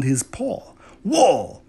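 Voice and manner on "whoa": startled whoa. A narrator's reading. Kitten yanked off by rope.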